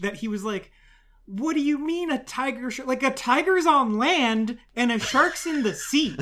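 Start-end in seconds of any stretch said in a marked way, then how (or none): 1.2-6.2 acted confusion building louder and higher; mock incomprehension, stretching the last question out; mind-blown bafflement. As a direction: demand an explanation, utterly baffled. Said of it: "blew this guy's tiny mind".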